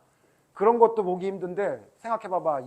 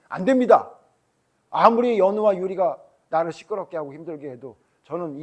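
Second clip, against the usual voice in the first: loud, raised-pitch protest; emphatic refusal; 8 dB louder than usual. Acted. Imagined leader pleads with God for his people, emphatic protest. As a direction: protest firmly, hitting the first words hard.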